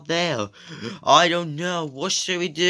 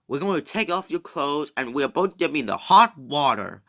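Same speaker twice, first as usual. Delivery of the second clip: ordinary delivery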